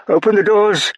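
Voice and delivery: high, loud shout while running. Acted. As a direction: yell ahead to clear the way. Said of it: "she shouted".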